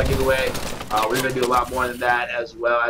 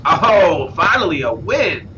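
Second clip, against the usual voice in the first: a sudden louder, higher "Oh" before the win; excited cheer; 4 semitones higher, 4 dB louder, slower than usual. Real, not acted. Caster cheering Chancho's first win to viewers, excited. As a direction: celebrate the comeback win.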